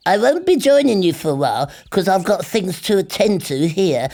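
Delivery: a friendly host inviting a guest; high cheerful rabbit voice. A narrator's reading.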